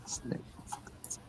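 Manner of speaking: breathy whispered repeat; hushed mock-secret whisper. Repeats the line in a hush.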